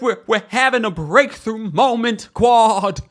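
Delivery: high, loud, hammed-up sobby voice; mock tearful announcement. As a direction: imitate an overwrought breakthrough. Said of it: "Why is she crying?".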